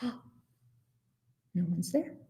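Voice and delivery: very soft, astonished discovery; gasp, then say it in a hush; small, quiet shock. A narrator's reading. Gasp on finding nobody, very soft.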